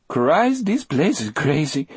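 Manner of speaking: high, trembling voice; rattled prisoner's high, trembling exclamation; Delacroix, trembling. A narrator's reading. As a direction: quaver nervously, pitched well up. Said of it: "Delacroix said in a trembling voice".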